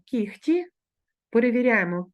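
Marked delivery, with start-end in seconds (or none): 0.0-0.7 кіхті said with a voiceless kh, kikhti; devoiced h pronunciation. Shown as a demonstration. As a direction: say кіхті as heard, kh not h. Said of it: "Ми вимовляємо".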